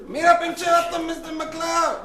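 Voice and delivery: loud, high, hammed-up Mexican accent; brash swaggering lawyer with a big Mexican accent, shouting. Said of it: "I don't know why I'm Mexican".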